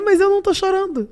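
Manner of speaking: lightly mimicked, high-pitched retort; imitating Lula. President answering a crying militant, mimicked protest.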